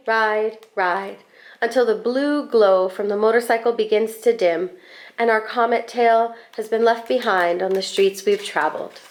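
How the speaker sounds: plain reading voice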